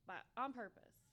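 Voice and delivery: normal talking voice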